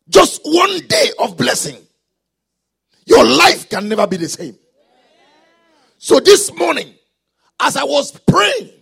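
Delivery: loud, emphatic preacher's declaration; full-force preaching. Real, not acted. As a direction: proclaim it to the congregation, punching key words loudly.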